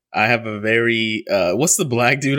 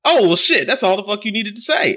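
much higher, louder pleased dad; imitating dad delighted; 11 semitones higher, 5 dB louder than usual. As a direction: go up high and loud like the dad finally satisfied. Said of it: "He goes".

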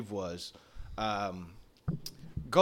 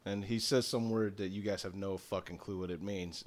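usual speaking voice

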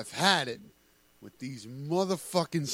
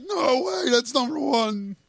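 high mock gasp of disbelief; 7 semitones higher than usual. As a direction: mimic his outburst, hammed-up. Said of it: "You're like".

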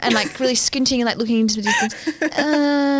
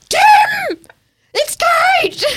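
high loud shout; shouting out window; 5 dB louder, much slower than usual. Retold or imitated. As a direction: yell it from the car window. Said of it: "wound down the window and was like".

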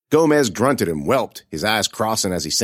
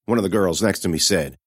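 ordinary delivery, nothing marked

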